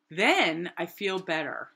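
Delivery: usual speaking voice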